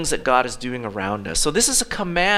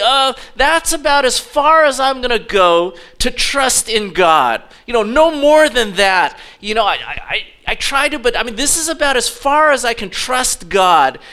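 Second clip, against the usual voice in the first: louder, higher acted excuse; reluctant protest; 6 semitones higher, 5 dB louder than usual. Believer telling God how far they'll go, protesting. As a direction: push back as the hesitant believer.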